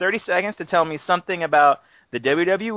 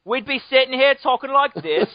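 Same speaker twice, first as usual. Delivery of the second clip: higher-pitched mock British accent; put-on British accent; 8 semitones higher, 3 dB louder than usual. Host joking about losing the Revolutionary War, mock British accent. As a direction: do a hammy, wobbly British accent. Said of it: "I don't know what accents I'm doing".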